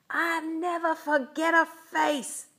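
high, loud vengeful yell; menacing shout. A narrator's reading. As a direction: holler a threat at escaping kids. Villain shouts after fleeing kids.

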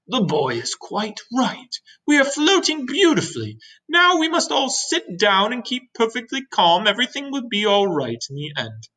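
high, stately grasshopper voice; old grasshopper reassuring. Acted. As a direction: settle everyone with formal assurance.

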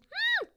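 muffled high hum. A narrator's reading. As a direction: hum through a closed mouth. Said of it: "a muffled".

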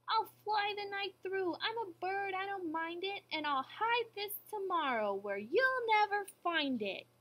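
high-pitched boastful bird; taunting eagle. A narrator's reading. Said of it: "over his shoulder he called back".

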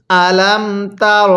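slow, measured "আলাম তার"; model recitation. Shown as a demonstration. Teacher has learners read along, slow model recitation of "আলাম তার". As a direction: recite "আলাম তার" with drawn-out syllables for repeating. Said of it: "আরেকবার পড়ুন".

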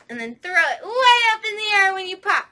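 fast, loud and high; excited shout. Acted. Telling the children to pop their scarves, excited loud call. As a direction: throw the words up with the scarf. Popcorn rhyme climax.

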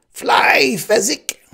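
piercing, very high scream; screamed command. A narrator's reading. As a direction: scream the order at full force. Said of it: "the Sicilian screamed".

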